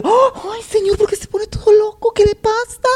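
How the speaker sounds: shocked high camp voice; flustered camp guy. Retold or imitated. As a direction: squeal it like a delicate guy gone frantic. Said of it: "todo alterado, así de".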